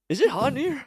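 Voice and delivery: high, squeaky acted nervousness; nervous deflection. Botley covering for his hidden companion, high and nervous. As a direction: blurt it high, dodging the subject.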